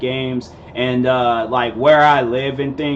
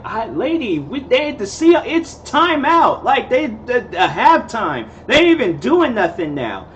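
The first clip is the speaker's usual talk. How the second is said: exasperated; 9 semitones higher than usual. Retold or imitated. Exasperated reply to drunk lady.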